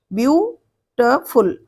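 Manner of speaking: "Beautiful" in three slow, separated beats; syllable by syllable. Shown as a demonstration. Teacher proving "beautiful" has three syllables, slowly split. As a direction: pause after each syllable of "beautiful". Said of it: "ये तीन syllable से बना है".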